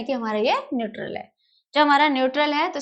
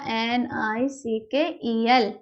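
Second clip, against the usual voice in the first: slow, letter by letter; spelling out; 2 semitones lower, much slower than usual. Shown as a demonstration. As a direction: spell it slowly for note-takers. (एक-एक अक्षर धीरे बोलना)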